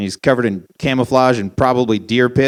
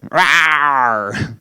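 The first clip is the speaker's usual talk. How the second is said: mock bear roar. Shown as a demonstration. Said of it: "scary like a bear".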